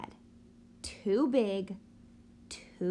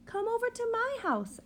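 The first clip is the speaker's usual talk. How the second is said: higher, quick excited invitation; eager call; 13 semitones higher, faster than usual. Acted. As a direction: call the friends over eagerly. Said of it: "I say".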